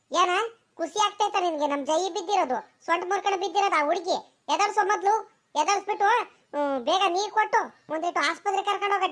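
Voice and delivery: put-on high, fast, bossy old mother-in-law; mother-in-law ordering her son to lift his injured wife, high and fast; scolding mother-in-law. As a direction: rush the useless son, sharp and shrill with worry.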